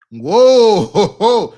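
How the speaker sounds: loud, drawn-out whoa; big reaction, very loud; stunned and booming; shocked whoa. Real, not acted. Reacting to the played clip.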